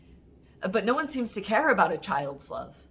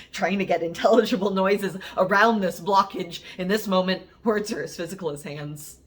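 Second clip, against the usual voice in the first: louder, urgent rush of words; choked urgency; 6 dB louder than usual. Acted. Poet describing words blocking their air pipe, urgent and forceful. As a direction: build in force like panic closing the throat.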